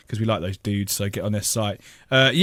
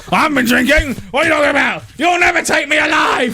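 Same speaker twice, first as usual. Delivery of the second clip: higher, louder hammy shouting; mock shout; 12 semitones higher, 4 dB louder than usual. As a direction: rant like a cornered drunk. Mock drunk denial.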